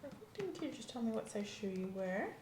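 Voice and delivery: ordinary talk, nothing marked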